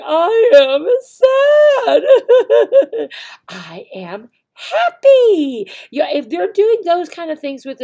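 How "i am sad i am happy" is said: hammed-up sad then laughing happy, high and loud; acted-out feelings. Shown as a demonstration. Teacher showing students acting out feelings, hammed-up and loud. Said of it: "But if they're doing".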